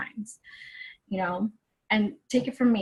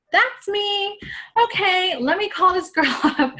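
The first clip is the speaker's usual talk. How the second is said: eager excitement; 9 semitones higher than usual. Retold or imitated. Excited about the coach's life.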